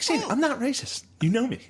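very high mock dad voice; imitating dad. Dad denying racism to Shane, high mock voice. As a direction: plead it in a high cartoon voice.

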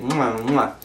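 two soft smacking kisses; kiss sounds. Shown as a demonstration. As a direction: kiss it twice, gently. Shows kissing the scapular.

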